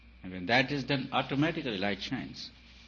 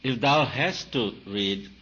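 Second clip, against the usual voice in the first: slow, loud, biblical cadence; solemn mock scripture; 8 dB louder, slower than usual. Preacher mock-quoting scripture to his listeners, slow and loud. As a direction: intone it like an old commandment.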